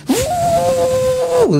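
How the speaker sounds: a sudden piercing high woo cry; high whoop. Retold or imitated. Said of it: "Yun ang narinig ko".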